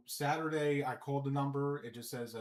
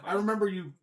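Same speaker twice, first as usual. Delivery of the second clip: higher, louder eager start; excited; 7 semitones higher, 11 dB louder, faster than usual. Real, not acted. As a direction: jump in with a fond memory. Excited recollection.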